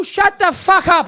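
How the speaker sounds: drawn-out acted outburst; angry parent. Comic plays mother refusing the glue request, slow angry outburst.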